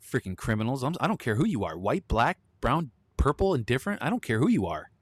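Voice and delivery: ordinary talk, nothing marked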